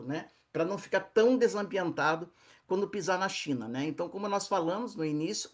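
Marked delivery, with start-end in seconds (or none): none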